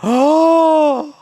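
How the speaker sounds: loud high gasp of shock; excited gasp. Real, not acted. Player stunned by her lucky catch, loud gasp. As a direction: suck in air, thrilled.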